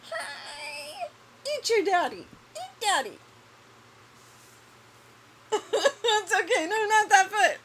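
put-on high squeaky baby talk; squeaky rubber duck. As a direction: coo at the kitten in a squeak.

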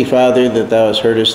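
ordinary talk

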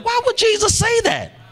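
preacher's sudden high shouted challenge. Real, not acted. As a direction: burst out in a soaring cry. Rhetorical climax.

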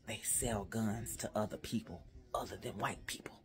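a hushed aside, breathed out; whisper. Acted. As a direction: lean in and whisper it like a secret.

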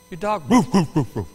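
barked woof woof, a playful imitation; mock dog bark.

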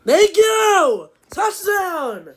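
high, loud cry of relief; excited cheer. Real, not acted. Finally scores a touchdown.

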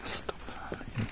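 a fast, breathy whispered prayer; quick whisper. Real, not acted. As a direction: rush the prayer out under the breath. Ghost hunter near the kuntilanak.

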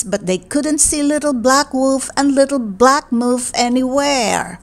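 ordinary delivery

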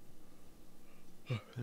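drawn-out yawn, breathy; long tired yawn. Real, not acted. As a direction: let out a big yawn. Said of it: "sorry I'm yawning so much".

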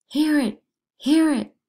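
high, loud cry of despair; man crying out his despair to the court; anguished shout. Acted. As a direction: hurl it at the whole court. Despairing outburst.

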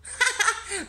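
high, loud burst of laughter; a boy's mocking guffaw. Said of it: "Nathan rit aux éclats".

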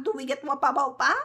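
normal talking voice

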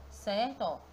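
ordinary delivery

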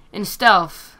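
normal talking voice

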